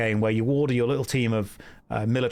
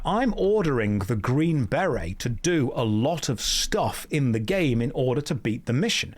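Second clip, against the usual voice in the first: normal talking voice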